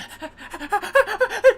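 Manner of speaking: high yelping laugh for the dog; mock dog yelp. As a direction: squeal like a caught dog. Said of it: "he was like".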